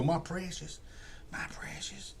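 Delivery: hushed raspy Gollum, fading to a whisper. Retold or imitated. Gollum crooning over his ring, hushed to a whisper.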